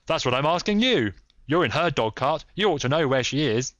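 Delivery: high, loud, insistent; heated retort. A narrator's reading. Cyclist to Holmes about the missing lady, heated. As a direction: throw the question back forcefully.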